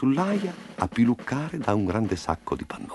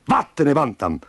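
stern, higher-pitched telling-off; angry dismissal; 6 semitones higher than usual. Acted. Farm boss sacking the little rooster, angry. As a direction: throw him out angrily.

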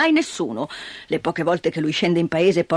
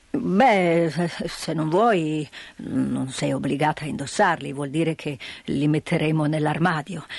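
gruff grandfather; 6 semitones lower, 3 dB quieter than usual. Acted. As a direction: give in gently like a gruff grandfather. Grandfather's low voice.

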